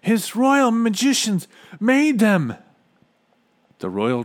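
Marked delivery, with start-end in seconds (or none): 0.0-2.5 loud, high and drawn-out exclamation; high-pitched indignant outburst; exclaiming. A narrator's reading. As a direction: cry it out slowly and loudly. Character exclaims in story.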